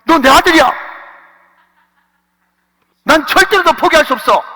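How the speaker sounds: high, loud pleading cry; impassioned plea. Acted. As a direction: cry out that you will never give up. God voiced pleading love. (높고 크게 간절히 외치듯)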